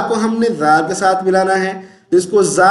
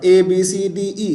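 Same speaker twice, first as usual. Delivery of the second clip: ordinary talk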